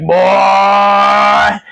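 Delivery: put-on booming high bark of a menacing old man; creepy old man yell. Tall man shouting at the fleeing boy, menacing bark. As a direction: call the boy like a sinister undertaker. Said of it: "he's like".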